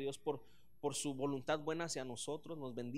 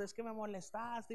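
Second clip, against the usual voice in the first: mock crying sister; 7 semitones higher, 3 dB quieter than usual. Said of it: "mi hermana llorando".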